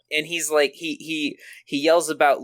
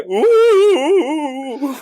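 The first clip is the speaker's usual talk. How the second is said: ghostly ooh; 9 semitones higher, 7 dB louder than usual. Acted. Ghost wail continued.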